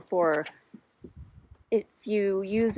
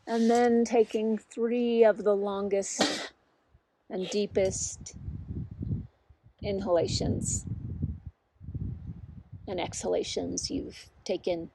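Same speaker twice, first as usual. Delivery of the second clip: unhurried guiding voice with long pauses; guided meditation. Real, not acted. Teacher settles listeners into body, mind and heart, unhurried guided meditation. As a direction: lead them gently inward, leaving long silences between phrases.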